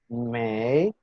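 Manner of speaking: mei drawn out, starting low and rising; second tone. Shown as a demonstration. Teacher demonstrating the first syllable, mei in a rising tone. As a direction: stretch mei upward like a question. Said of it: "está en segundo tono".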